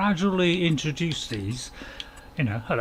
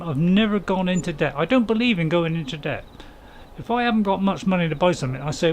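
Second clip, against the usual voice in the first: normal talking voice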